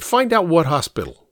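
brisk command; giving instructions. A narrator's reading. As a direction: send someone on an errand. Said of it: "he instructed".

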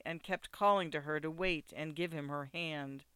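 ordinary delivery

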